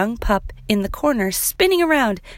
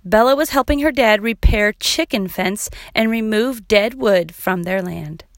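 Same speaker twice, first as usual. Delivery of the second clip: ordinary delivery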